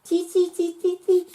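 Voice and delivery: very high squeaky chee; monkey chatter. Acted. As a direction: chatter back like a cheeky monkey.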